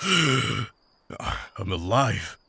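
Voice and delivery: very slow, spent after a sigh; barely getting the words out; drained relief; exhausted. Acted.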